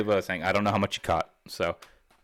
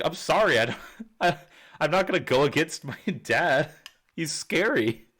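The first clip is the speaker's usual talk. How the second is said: higher, laughing fake apology; mock scared plea; 7 semitones higher than usual. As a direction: pretend to beg, half-laughing. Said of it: "And I'm like".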